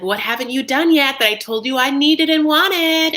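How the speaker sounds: high, loud mock exasperation; demanding. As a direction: press him like a fed-up fiancée. Acting out the woman's demand.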